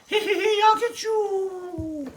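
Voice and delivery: high, loud cackling witch voice; witch cackle. Acted. Little witch cackling on her broom to take off, high cackle. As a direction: cackle it out like a storybook witch, slow and shrill. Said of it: "in her own voice".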